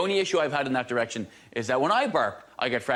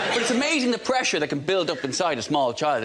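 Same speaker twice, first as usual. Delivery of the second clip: normal talking voice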